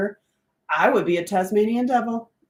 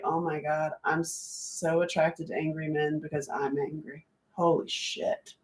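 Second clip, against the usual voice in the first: hushed realization; 3 semitones lower, 10 dB quieter than usual. Retold or imitated. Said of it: "I was like".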